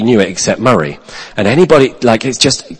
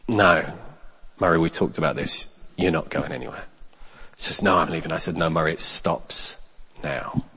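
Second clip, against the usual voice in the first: calm firm refusal; 6 semitones lower, 14 dB quieter than usual. Retold or imitated. Calm firm stand-off with angry man.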